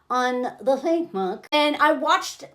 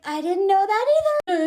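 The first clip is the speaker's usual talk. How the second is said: higher-pitched, insistent reply; imitated protest; 7 semitones higher than usual. Woman answering his outburst, imitated high protest. Acts her reply amid argument.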